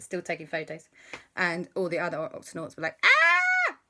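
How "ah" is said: very high, loud shriek; high, loud scream of fright; cartoon scream. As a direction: scream like a terrified cartoon captain. All Octonauts screaming.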